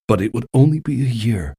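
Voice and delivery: ordinary delivery, nothing marked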